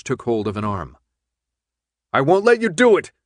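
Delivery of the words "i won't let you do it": high, loud scream. Acted. Thomas screams at the guards, panicked. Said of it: "Thomas screamed".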